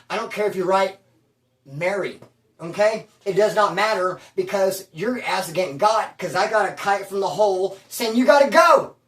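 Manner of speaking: ordinary talk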